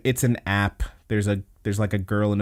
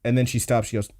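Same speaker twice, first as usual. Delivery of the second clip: ordinary talk, nothing marked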